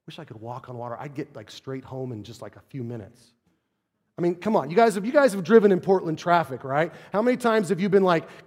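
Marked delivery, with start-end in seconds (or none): 0.0-3.3 much softer, lower musing aside; wistful grumble. Retold or imitated. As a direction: mutter it like a tired walker. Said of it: "look at it and go".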